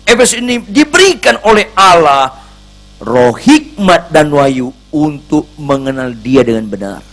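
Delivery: high, loud pulpit preaching; fiery preaching. Acted. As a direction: drive the message home with force. Preaching at full force.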